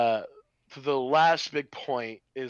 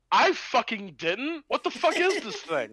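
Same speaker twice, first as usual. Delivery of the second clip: mock baffled audience; 7 semitones higher, slower than usual. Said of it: "me in the audience is like".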